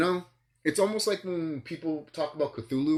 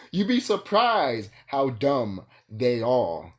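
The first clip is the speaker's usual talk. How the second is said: slow emphatic; 6 dB louder, much slower than usual. Real, not acted.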